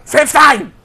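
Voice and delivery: high, loud mimicking bark; mock shout. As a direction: shout it back as a joke, putting on a voice. Mock-shouts the misspoken phrase back.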